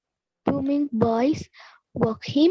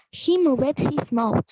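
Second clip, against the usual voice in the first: ordinary talk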